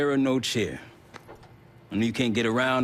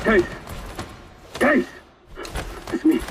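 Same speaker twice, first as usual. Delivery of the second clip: loud calls across a distance; calling out; 3 dB louder than usual. Acted. Marshall calling out for his partner Case. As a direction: shout the name to find him.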